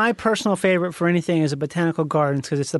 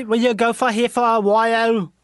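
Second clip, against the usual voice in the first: put-on higher, slow, goofy Dutch accent; mock Dutch accent; 5 semitones higher than usual. Host imitating the Amsterdam guy, goofy mock Dutch accent. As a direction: overdo a fake Dutch accent, stretched out. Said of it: "It's almost more like".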